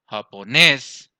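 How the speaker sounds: "japonés" slowly with a loud, long "nés"; slow "japonés", booming last syllable; stress on last syllable. Shown as a demonstration. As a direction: drag out and punch the "nés" of "japonés". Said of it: "En la última".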